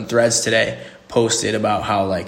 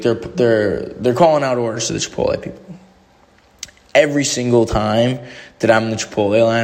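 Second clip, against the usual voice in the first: ordinary talk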